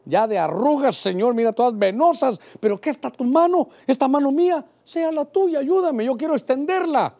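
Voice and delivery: high-pitched, urgent pleading prayer; impassioned prayer. Retold or imitated. Preacher showing how he prays for God's hand, pleading. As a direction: plead upward with growing urgency. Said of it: "yo voy a orar, le digo".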